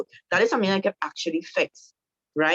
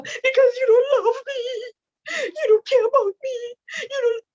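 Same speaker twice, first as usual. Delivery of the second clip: high wailing mock sob, hammed up; husband asks why she cries, mock tearful high wail; mock crying wife; 4 dB louder than usual. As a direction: wail it like a hurt wife, overdone. Wife voiced crying in skit.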